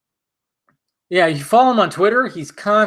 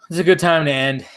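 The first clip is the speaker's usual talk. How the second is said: ordinary talk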